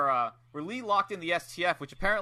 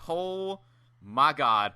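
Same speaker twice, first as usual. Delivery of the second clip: awed reaction; 4 dB louder, much slower than usual. Retold or imitated. Retelling awed reaction to slaps.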